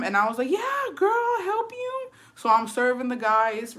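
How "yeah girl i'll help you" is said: very high singsong agreement; exaggerated, high-pitched helpful voice; put-on eagerness. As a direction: chirp it way up high. Acts out eager yes to friend.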